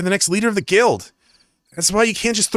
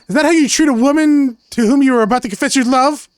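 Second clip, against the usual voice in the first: raised, louder indignant retort; 7 semitones higher, 4 dB louder than usual. Acted. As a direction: scold him for the insult, voice up high. Jess indignant at Kyle.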